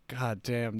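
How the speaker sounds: normal talking voice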